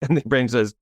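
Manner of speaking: usual speaking voice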